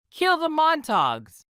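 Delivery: read-aloud crowd yell. Acted. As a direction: shout it from the crowd. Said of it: "yelled some".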